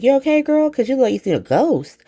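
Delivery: high-pitched imitated check-in; mock concern. Recapper voices manager running up on Joss, high mock concern. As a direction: voice a nosy friend's concern, starting way up high.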